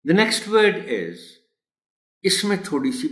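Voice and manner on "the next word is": very slow, drawn-out teacher announcement; teacher slowly announcing the next word. Shown as a demonstration. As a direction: draw out each word for the class. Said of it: "teacher slowly announces next word".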